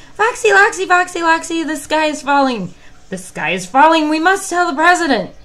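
very high, loud panicked shout; yelled. Acted. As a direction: yell the alarm at the fox. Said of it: "yelled Chicken Licken".

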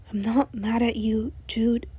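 slow, quiet and gentle; calm him down softly; soft reassurance. Acted. Soothing reply in bed at night.

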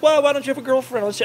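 ordinary talk

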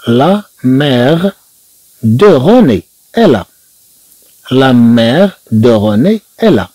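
slow, careful French sentence; drawn out so pupils can follow; slow model reading. Shown as a demonstration. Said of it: "teacher models slow French reading".

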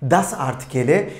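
usual speaking voice

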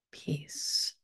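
a hushed, airy peace; whispered affirmation. Acted. Guide whispering an affirmation to the group, hushed. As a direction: breathe the word out as a whisper.

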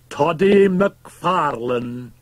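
high, loud, drawn-out hail; herald-like call repeated. A narrator's reading. Fettes confronting the shocked doctor, loud heraldic call. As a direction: call out the name slowly and loudly, like a herald. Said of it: "repeated Fettes".

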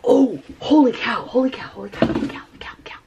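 gasping, fading to a whisper; awed holy cow. Real, not acted. Vlogger amazed at her paint, awed whisper. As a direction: gasp then whisper it over and over.

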